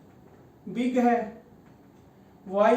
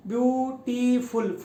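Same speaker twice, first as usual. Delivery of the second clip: Beautiful slowly in three beats, ब्यू-टी-फुल; syllable by syllable; slower than usual. Shown as a demonstration. Teacher counting three syllables for students, beautiful slowly split. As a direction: pause between view, टी and फुल. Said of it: "तीन syllable में मैंने word बोला".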